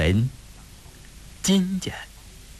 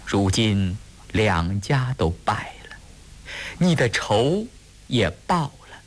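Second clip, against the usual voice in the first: tearful plea; 5 semitones higher than usual. A narrator's reading. Woman begs cousin for the child's sake, close to sobbing. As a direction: voice climbing higher toward tears. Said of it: "呜呜咽咽地放出了声".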